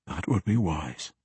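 softly voiced, almost whispered; hushed agreement; hushed, near whisper. A narrator's reading. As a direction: say it low and soft, just short of a whisper. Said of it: "he whispered".

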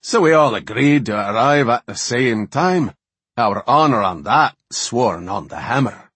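boisterous cheerful dwarf chief; jovial dwarf. A narrator's reading. Jovial Wildhammer dwarf continues.

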